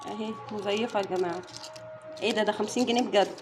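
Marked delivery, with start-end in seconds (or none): none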